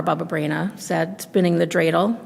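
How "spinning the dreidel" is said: ordinary delivery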